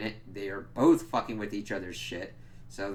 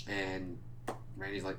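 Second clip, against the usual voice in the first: ordinary delivery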